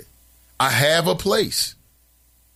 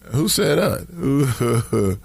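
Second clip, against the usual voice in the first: slow drawn-out; much slower than usual. Real, not acted. Drawn-out repeat.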